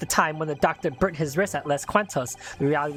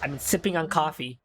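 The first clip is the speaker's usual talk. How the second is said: normal talking voice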